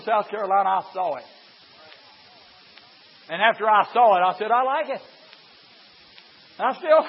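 loud, driving preacher's cadence; preaching at full force. Real, not acted. Preacher to congregation about teaching children praise, loud. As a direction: preach it hard and make them hear.

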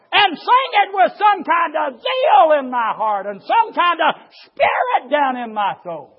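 loud, high shouted preaching; fiery preaching. Acted. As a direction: thunder it from the pulpit. Preaching at full force.

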